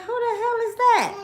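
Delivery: high-pitched, baffled voice of the other woman; confused young woman, voiced up high; imitating the girl. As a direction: play the startled girl. Girl reacting to the chasing car.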